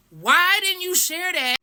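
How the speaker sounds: high, exasperated question; indignant retort. Retold or imitated. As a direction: demand it in disbelief. Acted retort at staff.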